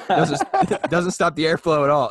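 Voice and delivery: cracking up while telling friends the story; laughing. Real, not acted. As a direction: laugh through the punchline.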